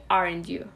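sure, expecting agreement; falling tag. Shown as a demonstration. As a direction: drop the voice on "aren't you?". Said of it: "Your voice goes down when you say".

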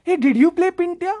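high-pitched gushing auntie voice; high, eager lady's question; excited older ladies. Retold or imitated. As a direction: imitate a mother's-age lady spotting a star. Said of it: "these adult ladies, like, my mother's age".

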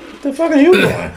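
surprised. Real, not acted. Reacting to leaning in to mic.

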